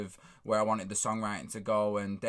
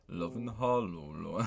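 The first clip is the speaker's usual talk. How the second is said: deep voice; 4 semitones lower than usual. Retold or imitated. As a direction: imitate singing it way down low. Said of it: "in this deep voice".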